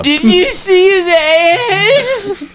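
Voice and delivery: high, slow squeaky voice; high silly voice. Retold or imitated. Repeating the funny voice.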